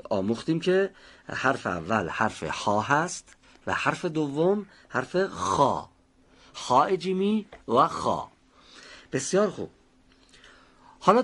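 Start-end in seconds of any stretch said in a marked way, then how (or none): 5.3-5.9 خا with a drawn-out scrape at the start; fricative kha. Shown as a demonstration. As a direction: stretch the rough friction of خ in خا. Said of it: "باید به صورت سایشی تلفظ بشه". (خا با سایش درشت و کشیده)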